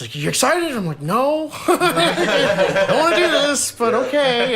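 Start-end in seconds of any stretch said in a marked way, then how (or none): 0.1-0.8 high-pitched, upbeat question; eager dad. Retold or imitated. Dad trying to have a good time asks his kid, eager. 1.0-4.5 high, drawn-out mock whine through laughs; reluctant kid. Scared kid answering dad before the roller coaster, reluctant.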